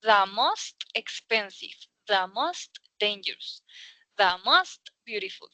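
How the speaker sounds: "da most" in every example, unhurried. Shown as a demonstration. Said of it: "toca como una A".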